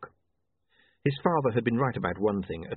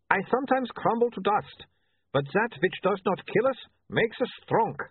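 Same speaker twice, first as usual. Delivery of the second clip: put-on light Uberwaldian accent, raised pitch; a hint of an Uberwaldian photographer; lightly voiced Uberwaldian accent; 11 semitones higher than usual. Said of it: "don't make fun of his accent".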